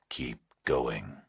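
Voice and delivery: slow, low, menacing whisper; distorted nightmare voice. A narrator's reading. Kidnapper on the phone to Burke, slow eerie whisper. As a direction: breathe it like a monster on the line.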